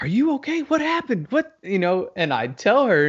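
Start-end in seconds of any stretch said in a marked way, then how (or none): 0.0-1.6 high-pitched, alarmed questioning; imitating worried wife. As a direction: put on a concerned wife's voice. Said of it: "And my wife is like".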